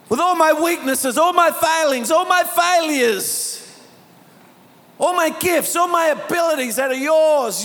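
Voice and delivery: high, impassioned sermon delivery; rousing declaration to a congregation; preaching at full force. Real, not acted.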